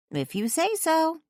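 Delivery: friend voice. A narrator's reading. Friend teasing on phone.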